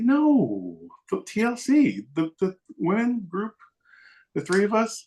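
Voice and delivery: high-pitched mock exasperation; retold incredulous explaining; exasperated correction. Woman corrects his wrong guess.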